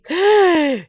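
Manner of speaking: a sharp, high, loud gasp of shock. Retold or imitated. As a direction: gasp as if you just saw something awful. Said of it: "she's shocked".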